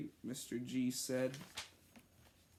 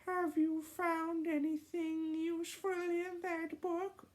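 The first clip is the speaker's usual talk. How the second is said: put-on much higher, thin child's voice; Ross voice. Ross asks the cousins about the book, high kid voice. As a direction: redo the line high like young Ross. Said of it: "I have to do it in the Ross accent".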